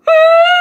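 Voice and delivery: very high, very loud scream; pent-up scream behind a closed door; scream of release. Retold or imitated. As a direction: let it all out in one scream. Screams once the counselor shuts door.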